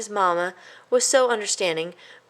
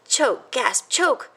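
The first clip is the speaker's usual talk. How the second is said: choking words, rising higher; sputtering; 6 semitones higher, much slower than usual. A narrator's reading. A choking mouse sputters, higher and strained. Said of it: "sputtered Christopher".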